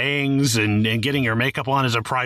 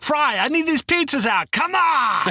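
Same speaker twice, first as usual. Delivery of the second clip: Fry's boss in Futurama; 14 semitones higher than usual. Retold or imitated. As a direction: bark at the delivery boy like a cranky pizza boss. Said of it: "I thought of Fry's boss in Futurama".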